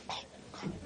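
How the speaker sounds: breathless, voiceless exasperation; whispered aside. Real, not acted. Preacher exasperated at the apostles, whispering. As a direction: whisper it under your breath.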